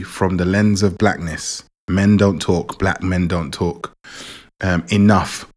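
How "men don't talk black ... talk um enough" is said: ordinary talk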